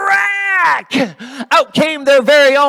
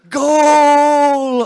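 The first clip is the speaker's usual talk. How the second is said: big excited shout of goal; 7 semitones higher, 8 dB louder than usual. Acted. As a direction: yell it like a sports commentator. Soccer goal shout in story.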